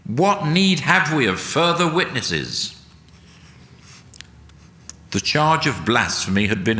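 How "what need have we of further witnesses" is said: high, lightly raised shout of triumph; shrill shout. A narrator's reading. High priest to the court, a mild shrill victory shout. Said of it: "He shouted".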